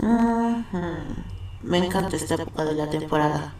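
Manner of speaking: demonic relish. Acted. Demon relishing the summer chaos, low soft sinister voice. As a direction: purr it like a demon back in hell.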